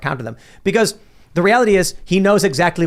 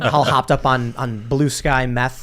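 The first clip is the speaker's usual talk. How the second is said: usual speaking voice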